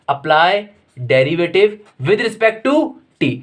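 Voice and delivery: very slow, drawn out dictation of the step. Shown as a demonstration. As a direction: let every word hang for the notes. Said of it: "dictates the step very slowly".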